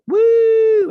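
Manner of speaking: very high, loud drawn-out whoop; excited woo. Acted. Viewer hoping the show picks up, excited whoop. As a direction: let out a high, cheering "woo". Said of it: "so I can be like".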